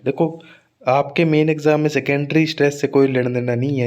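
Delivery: ordinary talk, nothing marked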